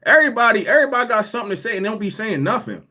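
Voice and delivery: loud and fast; loud rant. Real, not acted. Ranting about people on the lives, loud and fast. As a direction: rattle it off hard and quick.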